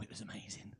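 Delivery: hushed, breathless whisper. Acted. Comic to crowd, a hushed amazed whisper. Whispered aside about the pilot.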